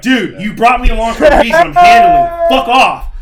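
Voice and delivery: loud and high, peaking on handling; exasperated shout. Retold or imitated. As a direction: shove the father out of the deal. Telling dad to back off.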